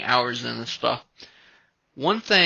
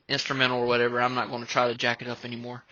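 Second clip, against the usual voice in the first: ordinary talk, nothing marked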